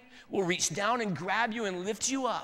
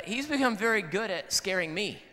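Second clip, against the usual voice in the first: normal talking voice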